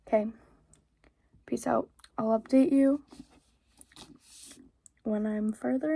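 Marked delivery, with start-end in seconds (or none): none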